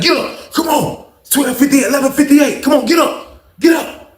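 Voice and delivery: high, excited imitation of a cellmate; insistent wake-up call. Cellmate getting a reluctant friend out of bed, excited nagging. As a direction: urge him up in quick high bursts.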